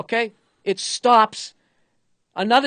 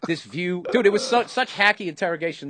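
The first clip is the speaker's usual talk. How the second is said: ordinary talk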